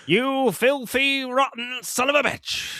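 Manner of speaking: high, slow, hammed-up insult; mock movie villain. Quoting the film, a hammed-up high slow insult. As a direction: drag out the slur in a high put-on voice.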